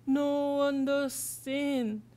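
put-on slow, halting foreign kid; broken English. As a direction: struggle to find each word slowly. New kid's broken English reply.

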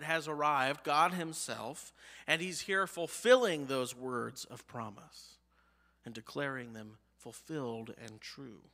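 ordinary talk, nothing marked

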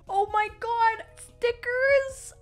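high, loud delighted squeal; excited discovery. Real, not acted. Fan discovering stickers in the parcel, excited.

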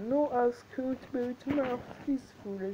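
slow, hammed-up English accent reading the game text; a bad attempt at an English accent; French gamer faking an English accent; mock English accent. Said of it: "J'ai l'impression d'avoir un super accent anglais".

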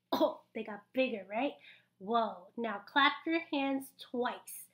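ordinary talk, nothing marked

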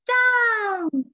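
high, loud, drawn-out falling shout; witch's cry. A narrator's reading. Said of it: "cried the witch".

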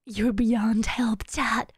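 low whispered tease. Pretend. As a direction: breathe it out like a secret.

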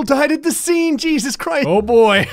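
high, loud alarmed outburst; shocked realization. Real, not acted. Player realizing the crash was their car, shocked. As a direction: blurt it out as it sinks in.

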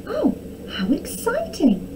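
high, thrilled princess exclamation; excited cry. A narrator's reading. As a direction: exclaim with delight, pitched high. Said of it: "cried Arabella".

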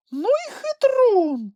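very high, loud, drawn-out squeak; high squeaky creature delight; delighted exclamation. A narrator's reading. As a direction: cry out in admiration, stretching it. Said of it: "захоплено скрикнув Чмих".

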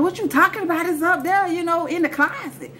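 high-pitched, incredulous comeback; baffled protest. Retold or imitated. Girl telling him where it is, high baffled protest. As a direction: answer high, as if it's obvious. Retold baffled answer to accusation.

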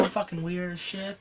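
normal talking voice

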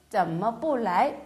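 each syllable spelled out slowly; slow model reading. Shown as a demonstration. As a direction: read it slowly for learners. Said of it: "이렇게도". (천천히 또박또박 읽는 예문)

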